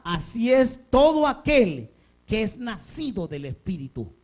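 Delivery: slow, loud, measured proclamation; emphatic scripture reading. Real, not acted. Preacher reads Jesus's words to church, slow and emphatic. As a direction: read it out deliberately with long pauses.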